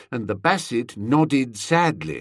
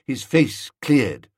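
ordinary delivery, nothing marked

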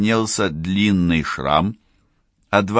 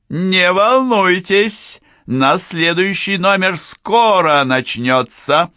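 put-on high, theatrical old-school showman; booming circus ringmaster; 8 semitones higher, 4 dB louder than usual. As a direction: announce it grandly to the audience. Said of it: "особую театральную интонацию загримированного конферансье или иллюзиониста старой закалки".

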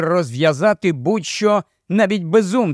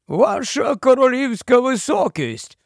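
gloomy marsh-wiggle; 8 semitones higher than usual. A narrator's reading.

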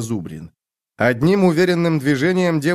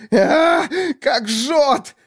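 very high cry of pain; much slower than usual. A narrator's reading. Man over the fire cries out that it burns, in pain. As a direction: cry out as the burn hurts.

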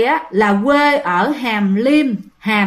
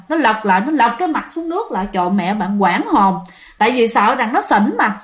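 plain reading voice

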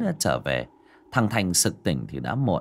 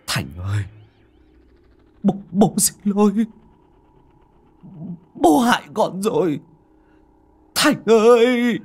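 choked last words; 11 semitones higher, 6 dB louder than usual. Acted. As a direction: voice rising into an anguished cry. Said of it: "nói ra những lời nghẹn ngào cuối cùng". (tiếng gọi nghẹn ngào tuyệt vọng)

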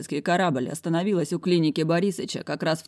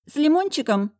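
much higher, squeaky offer; yapping secretary; 11 semitones higher, 4 dB louder than usual. A narrator's reading. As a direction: squeak it like a silly clerk. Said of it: "вякнуло недоразумение".

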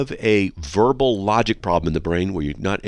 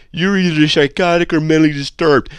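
mocking; 7 semitones higher, 8 dB louder than usual. Mocking the caller's phrasing.

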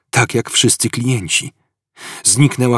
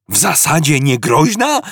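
higher, incredulous rising shout on "niegroźna"; shouted question; 6 semitones higher than usual. A narrator's reading. Arthur repeats Ford's words, incredulous shout. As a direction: throw it back at him in disbelief. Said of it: "krzyknął Artur".

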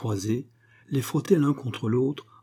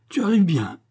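high, louder irritated cry; angry exclamation; 8 semitones higher, 5 dB louder than usual. A narrator's reading. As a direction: exclaim it crossly at an intruder. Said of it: "s'écria celui-ci".

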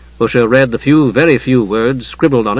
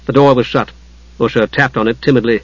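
plain reading voice

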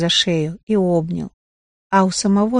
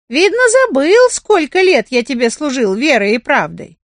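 higher, louder offended rooster voice; indignant rooster; 5 semitones higher, 4 dB louder than usual. Acted. Rooster reproaches the carpenter, higher and offended. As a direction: scold like a wronged old servant.

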